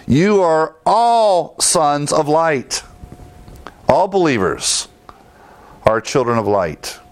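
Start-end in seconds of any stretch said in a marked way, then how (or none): 0.1-2.8 all drawn out slow and high; all stretched and lifted above the rest; stress on all. Shown as a demonstration. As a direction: lean hard on the word all, slowly. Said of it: "notice here the word all".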